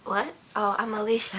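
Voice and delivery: sudden loud correction. Real, not acted. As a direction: blurt it out loudly. Corrects herself, laughing.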